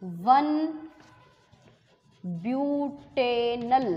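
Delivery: name read out piece by piece, slow; dictating slowly. Shown as a demonstration. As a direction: spell the name out slowly for the class to write. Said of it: "अब एक नाम और सुनिए". (धीरे-धीरे नाम लिखवाते हुए)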